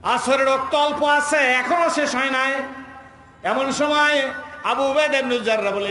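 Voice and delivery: high declaimed narration; impassioned preaching. A narrator's reading. Preacher telling of Abu Ubaidah near sunset, impassioned preaching. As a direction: lift the voice to announce a turning point. Preaches battle story at full force.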